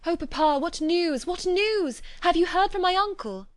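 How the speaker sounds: high and loud, breathless eagerness rising on each news; panting after her father, she calls out eagerly; eager cry. A narrator's reading. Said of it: "eagerly cried out".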